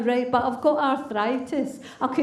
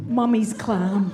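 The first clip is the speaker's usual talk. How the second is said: slow deadpan reveal; 4 semitones lower, slower than usual. Acted.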